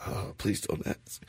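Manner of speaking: put-on whispered, slow, guilty kid; whispered sheepish plea. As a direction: breathe it out like a caught child. Kid answering angry dad.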